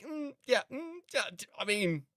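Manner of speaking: high, hesitant mock agreement; sceptical mm yeah. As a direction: hum along doubtfully, not buying it. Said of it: "I'm like".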